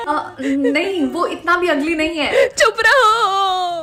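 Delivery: tearful "shut up", drawn out and slow; crying. Acted. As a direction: sob it out, hurt and angry. Upset friend told she's ugly.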